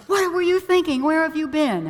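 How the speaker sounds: loud, high-pitched acted outburst; exasperated parent's mock yell; upset parent scolding. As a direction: scold a child who has gone missing. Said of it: "She just becomes so upset with him".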